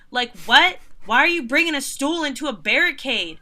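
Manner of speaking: can't believe what you're seeing; real exasperation; incredulous rant. Real, not acted. Ranting about tall men on stools.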